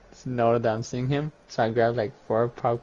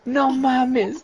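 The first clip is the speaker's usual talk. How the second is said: disbelieving exclamation; 11 semitones higher, 4 dB louder than usual. Real, not acted.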